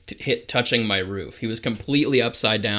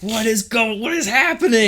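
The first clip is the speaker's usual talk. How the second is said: much higher, louder, incredulous what-is-happening; baffled disbelief; 12 semitones higher, 4 dB louder than usual. Retold or imitated. Driver retelling the drunk passenger, pitched way up in disbelief. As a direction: squeak it out in total disbelief.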